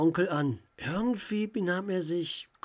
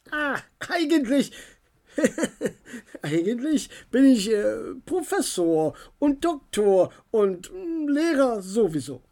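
higher, embarrassed chuckling professor; sheepish uncle; 6 semitones higher, 5 dB louder than usual. A narrator's reading. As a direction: own up with a sheepish laugh. Uncle admits he's professor.

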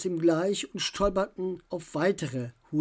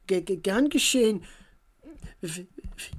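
stuttering; 2 semitones higher than usual. A narrator's reading. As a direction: trip over the first sounds. Shy boy stutters.

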